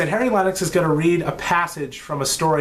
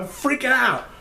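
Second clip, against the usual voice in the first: excited; 8 semitones higher than usual. Real, not acted. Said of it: "That's how excited I am".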